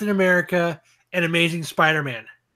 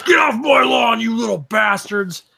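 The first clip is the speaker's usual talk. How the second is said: put-on grumpy old man yelling, higher; old guy voice; 5 semitones higher, 3 dB louder than usual. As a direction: yell like a cranky old neighbor. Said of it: "my old guy voice".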